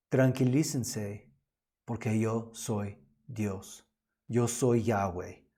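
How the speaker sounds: steady the frightened disciples gently; calm reassurance. Acted. Jesus reassures frightened disciples, slow and calm. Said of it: "Jesús te está diciendo".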